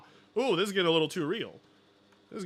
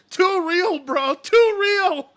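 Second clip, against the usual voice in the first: laughing; 9 semitones higher, 8 dB louder, much slower than usual. Real, not acted. Laughing at clip.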